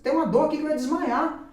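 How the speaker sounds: high, loud retold amazement; astonished excitement. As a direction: act out someone reeling at a sudden healing. Acts healed man's astonishment. (espanto eufórico, voz aguda e alta)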